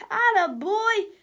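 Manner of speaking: high, loud, slow cheer; cheering cry. A narrator's reading. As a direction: cheer your little buddy on. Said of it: "cried George".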